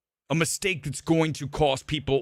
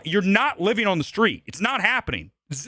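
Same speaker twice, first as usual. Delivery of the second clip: usual speaking voice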